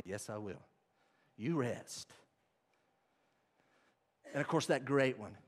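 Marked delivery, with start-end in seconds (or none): none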